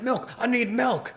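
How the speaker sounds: high-pitched, urgent mock demand; frantic customer. Bartender imitates guy with napkins, frantic customer. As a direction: imitate a man desperate for milk. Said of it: "And he's like".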